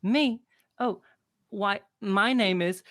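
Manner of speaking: put-on a small girl owning up to the barkeep; little girl apologizing for the bird. Little girl about to answer.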